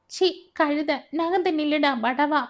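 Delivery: barking him off like a mistress to hired help; scornful shout. A narrator's reading. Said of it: "கத்தும் தோரணையில் ... அதட்டிவிட்டாள்".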